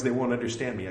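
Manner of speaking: usual speaking voice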